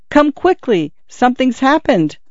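high-pitched, alarmed call; urgent cry. A narrator's reading. Keeper summoning the others to the ravine, urgent high cry. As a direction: cry out for help without real volume. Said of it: "suddenly he cried".

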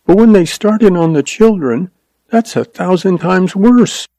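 put-on high, earnest young schoolteacher, a touch of anger; lightly voiced girl blaming the outlaw; young woman's voice. A narrator's reading.

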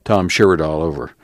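ordinary delivery, nothing marked

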